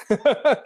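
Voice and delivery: a quick rising chuckle; short high laugh. Real, not acted. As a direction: laugh lightly at yourself. Laughs after "way too long.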